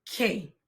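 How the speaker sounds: "K" said like English "kay" for Spanish qué; pronunciation demo. Shown as a demonstration. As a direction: model "K" as one clear syllable, "kay". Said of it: "it's pronounced".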